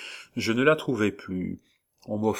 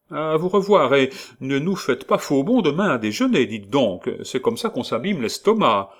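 high, loud motherly landlady voice; kindly landlady; 6 semitones higher, 6 dB louder than usual. Acted. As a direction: chirp it like a bustling landlady.

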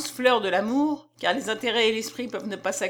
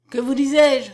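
louder, exclaimed remark; a louder outburst from the narrated character; exclaiming; 3 semitones higher, 8 dB louder than usual. A narrator's reading. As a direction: exclaim it out loud. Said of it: "s'écria Petit Claud".

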